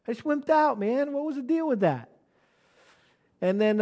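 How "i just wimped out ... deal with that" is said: high-pitched, exasperated talking to himself; exasperated self-reproach. Retold or imitated. Pastor scolding himself after leaving the place, exasperated. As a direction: kick yourself in a higher voice.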